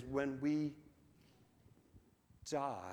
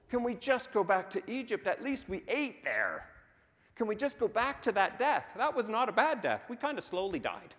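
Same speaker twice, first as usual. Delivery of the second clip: mock-angry complaint building from high and loud to much higher and louder; getting mad; 9 semitones higher, 7 dB louder than usual. Israelites complaining to Moses at the sea, building hammed-up anger. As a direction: whine at Moses, climbing into outrage. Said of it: "They're getting mad".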